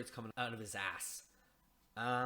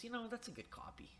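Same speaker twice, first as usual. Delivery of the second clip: snooty critic; 11 semitones higher, 6 dB quieter than usual. Retold or imitated. Carl's snooty verdict.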